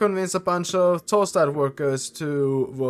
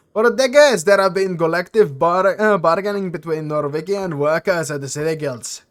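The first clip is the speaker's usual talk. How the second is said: louder explaining dwarf; dwarf explaining the guilds, a touch louder; lightly voiced dwarf; 3 semitones higher, 7 dB louder than usual. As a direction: lay out the grievance firmly.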